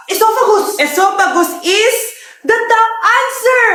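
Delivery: slow, drawn-out top answer reveal; game show host. Acted. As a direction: announce it like a quiz host.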